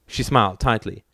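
ordinary delivery, nothing marked

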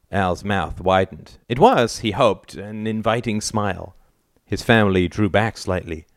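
usual speaking voice

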